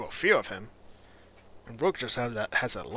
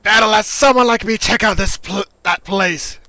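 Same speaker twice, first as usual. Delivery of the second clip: loud, higher-pitched game character; Echo; 10 semitones higher, 16 dB louder than usual. Acted. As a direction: push it out loud and high, full of bluster.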